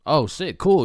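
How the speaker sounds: ordinary talk, nothing marked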